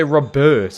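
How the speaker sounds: "Robert" with the stress moved to the second syllable; refused pronunciation. Shown as a demonstration. Streamer answering criticism of his accent, "Robert" stressed ro-BERT. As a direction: say "Robert" as ro-BERT, loud and high. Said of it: "That's just how I say Robert".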